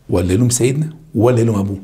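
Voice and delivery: normal talking voice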